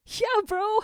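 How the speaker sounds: high, loud breezy bro reply; casual bro. Acted. Gadget answering Zero too casually, high breezy slang. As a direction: toss it off like a buddy.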